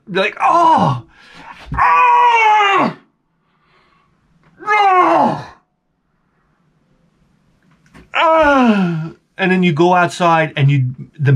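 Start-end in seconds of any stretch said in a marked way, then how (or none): 0.4-9.1 long, high wail of protest; drawn-out mock-agonized howls; anguished groan. Child told to weed the garden, hammed-up anguished groans.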